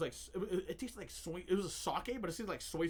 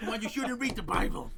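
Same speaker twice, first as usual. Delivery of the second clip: higher, louder mock podcast intro; late-night children's show host; 5 semitones higher, 8 dB louder than usual. Host addressing children about reptilians, hammed-up announcer. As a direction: boom it out like a kids' show host. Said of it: "His podcast is on in the middle of the night".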